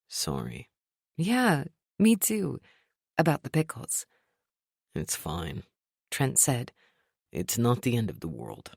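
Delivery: low, soft apologetic voice; calm man's voice. A narrator's reading. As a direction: quietly make amends. Trent calmer, low.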